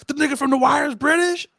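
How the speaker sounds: high-pitched, incredulous question; can't believe what you just learned; astonished disbelief. Retold or imitated. Host retelling how learning he was British blew his mind, astonished.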